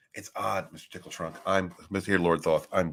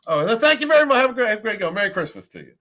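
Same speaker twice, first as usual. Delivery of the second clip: high, loud sugary lady voice; mock sweet lady; 11 semitones higher, 5 dB louder, faster than usual. Imitating the Salvation Army lady, high gushing thanks. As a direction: gush it like a syrupy church lady. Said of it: "And she's like".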